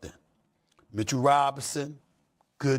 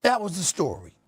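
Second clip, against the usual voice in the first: normal talking voice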